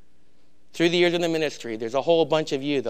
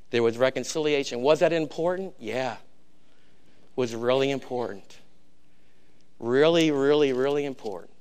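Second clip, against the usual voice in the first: normal talking voice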